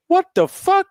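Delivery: impersonating a stunned moviegoer; mock shocked yell. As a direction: squeak it out in outrage. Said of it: "this one dude turns around and goes".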